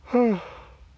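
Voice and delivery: high, loud exasperated sigh. Real, not acted. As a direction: let out a big weary sigh.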